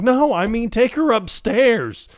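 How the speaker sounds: high, slow mock correction; hammed-up colonel, high; colonel quote. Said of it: "And he's like".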